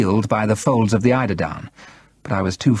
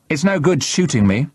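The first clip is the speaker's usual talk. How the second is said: higher-pitched, mildly defiant protest; 7 semitones higher than usual. A narrator's reading. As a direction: stand your ground against a threat. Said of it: "I said defiantly".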